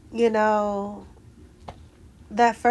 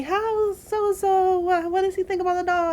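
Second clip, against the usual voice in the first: 1 curious relatives; 10 semitones higher, 3 dB louder than usual. Retold or imitated. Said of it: "my brother and his wife asking me".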